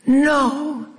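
a light incredulous no. Acted. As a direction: say it in mild disbelief. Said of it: "he said, incredulous".

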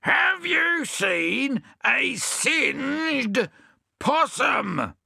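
roared. Acted. Bill roaring the question at the deaf hedgehog. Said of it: "roared Bill".

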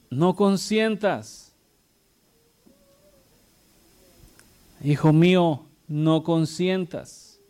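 slow, weighty repetition of the command; slow solemn warning. Acted. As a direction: drag no consientas out as a fatherly warning. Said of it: "repeated solemn command".

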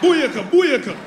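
high, loud Jamaican shout of booyaka; Jamaican cheer. Retold or imitated. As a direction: cheer it like a Jamaican dancehall crowd. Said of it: "Jamaican women by nature are real fiery".

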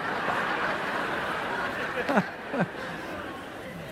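a breathy laugh at his own line; airy laugh. Real, not acted. Pastor laughing at his paraphrase joke, breathy laugh. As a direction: laugh softly under the breath.

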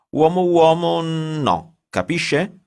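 high, loud, slow stressed refusal; slow, loud, high insistence; emphatic ban. A narrator's reading. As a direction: hammer home the rule.

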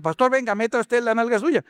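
high, whiny plea to the pastor; pleading churchgoer. Retold or imitated. Churchgoer begging the pastor to stay and pray, whiny. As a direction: beg the pastor like a suddenly devout member.

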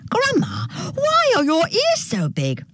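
put-on very high-pitched girl's question; wondering little girl. A narrator's reading. Girl questions her strange-looking grandma, very high wondering voice. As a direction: ask high, as a wondering child.

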